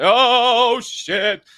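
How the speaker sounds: sudden high, loud dismay; startled dismayed exclamation; shocked. Retold or imitated. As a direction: react to bad news hitting hard. Card declined again.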